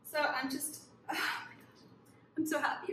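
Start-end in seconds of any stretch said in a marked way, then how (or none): 1.1-1.8 breathless whisper. Real, not acted. Overwhelmed by the book.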